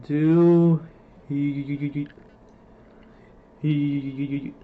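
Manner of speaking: stuck on a stretched, repeating vowel; stuttering. Real, not acted. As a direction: stammer and fail to get the word out. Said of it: "can't even say the word".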